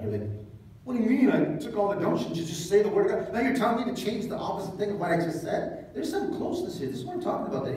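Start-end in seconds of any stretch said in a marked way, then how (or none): 0.8-5.9 mock indignant protest. Prophet protesting God's change.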